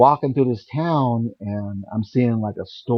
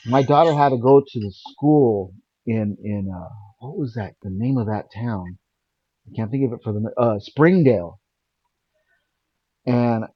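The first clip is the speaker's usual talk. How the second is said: ordinary talk, nothing marked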